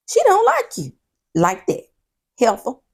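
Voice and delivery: loud, high sassy mocking; mock them with attitude. Real, not acted. Host mocking the couple's fight, loud and sassy.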